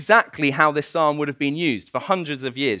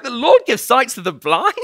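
high, loud acted astonishment; amazed realisation; 8 semitones higher, 5 dB louder than usual. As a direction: blurt it out as it dawns on you. Acted dawning realisation of disciple.

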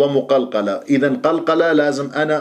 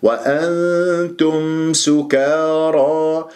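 وأنتم سكارى chanted very slowly, long hum before the ت; hidden nun; 2 semitones higher, much slower than usual. Shown as a demonstration. Teacher models the correct verse, drawn-out recitation. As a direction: hold the nasal hum in وأنتم. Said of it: "نضم الشفاه فيها".

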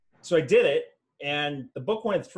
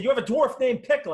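exasperated editor; 9 semitones higher, 5 dB louder than usual. Retold or imitated. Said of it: "He said".

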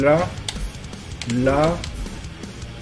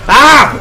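yelp and laugh; 10 semitones higher than usual. Real, not acted. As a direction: yelp then burst out laughing. Fail then laugh.

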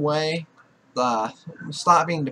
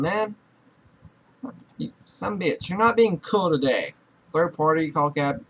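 ordinary delivery

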